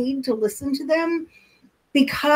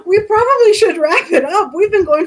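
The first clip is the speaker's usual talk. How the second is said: laughing; 8 semitones higher, 5 dB louder than usual. Real, not acted. Laughing as they realize the time.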